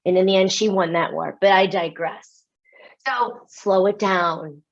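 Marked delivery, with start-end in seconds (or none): none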